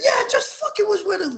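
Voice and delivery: very high, loud exclamation; excited boast. Retold or imitated. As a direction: squeal it out proudly, loudly. Acted excited talk with kids.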